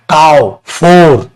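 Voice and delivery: काऊ and फोर stretched very slowly; slow classroom vowel demo. Shown as a demonstration. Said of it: "ओ से आ की sound है".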